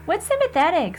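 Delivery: put-on a small boy telling mommy about nightmares; little boy voice. Little brother telling mom, high kid voice.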